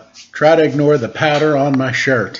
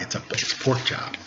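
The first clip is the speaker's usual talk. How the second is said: whispered dad joke; 3 semitones lower, 12 dB quieter, faster than usual. Real, not acted. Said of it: "dad joke material".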